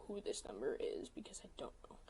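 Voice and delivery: quick curious whisper under the breath. Real, not acted. Telling her friend about a mystery number, whispered. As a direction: whisper it fast, like a secret aside. Wondering aloud about unknown texter.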